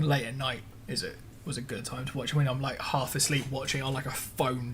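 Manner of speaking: usual speaking voice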